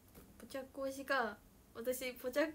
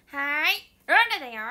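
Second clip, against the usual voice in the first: Rola impression; 3 semitones higher, 17 dB louder than usual. Showing viewers her impression of the celebrity Rola, loud and bubbly. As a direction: chirp it out like the TV star Rola. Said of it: "ローラさんのモノマネもできるんですよ".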